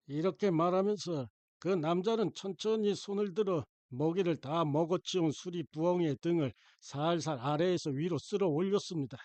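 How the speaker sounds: plain reading voice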